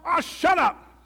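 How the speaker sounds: very loud, high yell; fed-up toad shouting; exasperated outburst. A narrator's reading. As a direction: cut the announcer off with a shout. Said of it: "said Toad".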